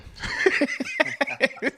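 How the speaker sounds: airy high laugh, no voice; high breathy laugh. Real, not acted. To chat after his own joke, a breathy laugh. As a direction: let a light laugh slip out.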